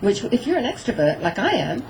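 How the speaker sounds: usual speaking voice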